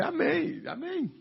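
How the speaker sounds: a touch of forced agreement, high falling amen; mock resigned amen. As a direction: squeak out a dutiful amen. His resigned reply retold.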